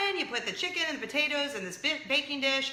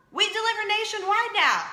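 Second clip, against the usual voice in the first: higher, louder, thrilled news delivery; excited announcement; 10 semitones higher, 7 dB louder than usual. Retold or imitated. Friend breaks big news to the host, excited announcement. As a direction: announce it big and bright-eyed.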